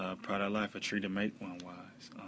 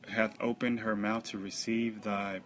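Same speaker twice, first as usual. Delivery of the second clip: loud, higher, slow declamation; scripture proclamation; 3 semitones higher, 9 dB louder, slower than usual. Acted. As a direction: proclaim the verse forcefully and slowly. Reads scripture aloud.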